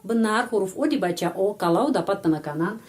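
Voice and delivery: normal talking voice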